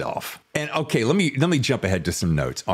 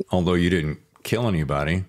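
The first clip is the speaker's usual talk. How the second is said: ordinary talk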